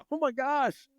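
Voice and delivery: high, amazed gasp of disbelief; mock stunned as if seeing dad in stands; stunned surprise. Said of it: "And I'm like".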